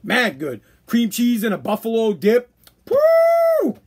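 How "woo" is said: high, loud joyful whoop; excited whoop. Real, not acted. Host cheering cream cheese buffalo dip with a high whoop. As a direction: let out a sudden cheer of delight.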